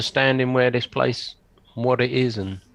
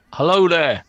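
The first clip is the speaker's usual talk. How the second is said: raised, higher-pitched hail; calling out; 6 semitones higher, 6 dB louder than usual. Acted. Player hailing a fisherman by the lake, calling out. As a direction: call out a greeting across a distance. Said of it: "I just call out to him".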